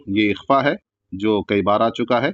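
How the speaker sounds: usual speaking voice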